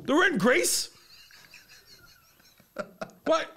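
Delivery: mock-outraged, pitched right up; exasperated correction. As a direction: correct an idiot in a high yell. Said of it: "And I wanted to go".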